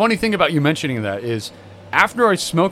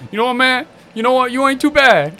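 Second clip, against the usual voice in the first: approving brother; 8 semitones higher than usual. Retold or imitated. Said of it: "and he goes".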